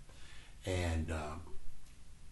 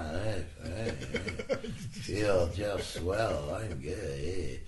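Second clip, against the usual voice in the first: slow, dragged-out, drained reassurance; mock worn-out mumble; 2 semitones lower, much slower than usual. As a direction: drag it out like a battery running down. Acts himself worn out, reassuring others.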